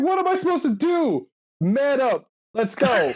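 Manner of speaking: pushy, overdone macho bark; hammed-up tough guy. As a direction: parody the soldier pumping himself up. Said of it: "He's like".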